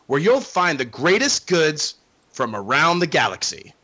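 theme park announcer read; smooth promo announcement. Acted.

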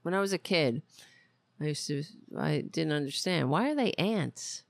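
ordinary talk, nothing marked

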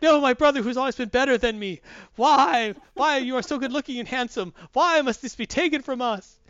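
hammed-up grief, high and loud; Thor. Stage-play Thor mourning his brother, melodramatic lament. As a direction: wail it like a terrible stage tragedian. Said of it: "And Thor's like".

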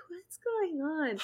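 slow, soft, spaced-out question; dazed and stoned. Retold or imitated. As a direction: sound totally out of it, slowly. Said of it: "And I'm just like".

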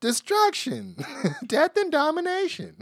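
slow confused voice climbing high; monster voice building up. Pretend. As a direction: start dazed and slow, rise to a squeal. Mock monster chant, rising pitch.

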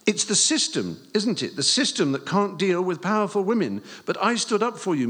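high, loud, earnest rehearsed speech; reciting his script. Acted. Boy reciting his script to teacher, high and earnest. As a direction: declaim it like a boy's prepared speech. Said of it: "remembered some of his script".

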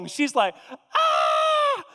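high piercing mock scream; a daughter's retold "Ah!" scream, high and loud. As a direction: shriek like a panicked daughter. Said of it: "like this, and she's freaking out".

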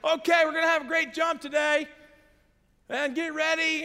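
loud, high, hammed-up jump instructor; excited shouting instructor voice; parachute guy shout. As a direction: bellow it like a gung-ho parachute guy. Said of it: "And he's like".

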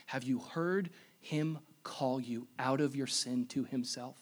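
ordinary talk, nothing marked

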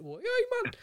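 high-pitched mock Geordie cry; Geordie accent. Fan teasing Newcastle supporters, mimicked Geordie accent. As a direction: put on a playful Geordie accent. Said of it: "stop mimicking the accent".

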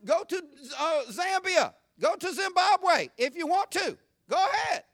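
high, loud, fervent preacher at full force; fiery preaching. Acted. Pastor scolding critics of America, preaching at full force. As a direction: thunder it from the pulpit like a challenge.

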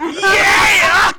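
high, loud shouted yeah; whooping agreement. Real, not acted. Guest agreeing with a joke, loud whoop. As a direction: cheer the joke on loudly.

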